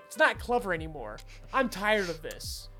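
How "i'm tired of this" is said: ordinary talk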